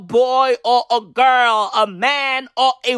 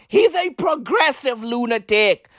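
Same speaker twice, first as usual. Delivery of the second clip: normal talking voice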